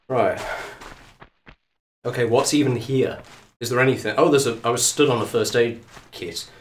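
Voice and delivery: ordinary delivery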